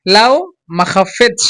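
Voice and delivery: "লাও", a short pause, then "মাখাফেচ" run together. Shown as a demonstration. Teacher shows how to say it quickly, "লাও", brief stop, joined "মাখাফেচ". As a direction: say "মাখাফেচ" in one piece after stopping briefly on "লাও". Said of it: "একসাথে যদি তাড়াতাড়ি বলতে চান".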